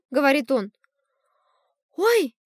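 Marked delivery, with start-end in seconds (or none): 2.0-2.3 испуганный вскрик. A narrator's reading. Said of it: "кричит мама".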